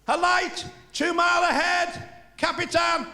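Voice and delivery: high, shouted alarm call to the captain; German lookout. Acted. German lookout shouting a warning to his captain. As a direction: yell the warning across the deck. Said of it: "He said".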